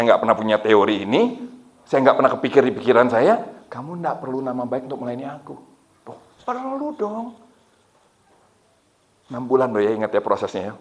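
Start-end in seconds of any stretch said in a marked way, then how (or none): 3.7-5.5 quiet, calm inner voice; lightly voiced imitation of God. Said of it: "ini kayaknya Tuhan yang ngomong". 6.1-7.5 high, soft, drawn-out objection; mock-plaintive pushback, pitched way up; retold protest. Retold protest answering God.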